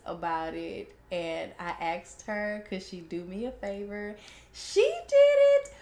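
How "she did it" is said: very high, loud, drawn-out announcement; excited squeal. Real, not acted. Host revealing great news to listeners, singsong excited squeal. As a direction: squeal the big reveal slowly.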